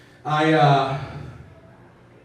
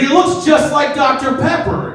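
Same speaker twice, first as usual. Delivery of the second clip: high indignant; 10 semitones higher, 4 dB louder than usual. Retold or imitated. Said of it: "He's like".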